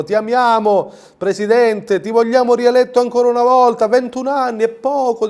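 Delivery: high, gushing mock crowd cheer; Calboni fawning. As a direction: swoon over the President like a sycophant. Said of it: "c'era pure Calboni che diceva". (Calboni adulante, voce alta e sdolcinata)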